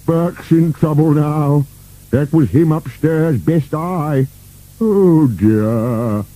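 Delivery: slow, high-pitched character lament; moaning. A narrator's reading. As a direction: fret slowly over the coming trouble. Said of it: "moaned Boney".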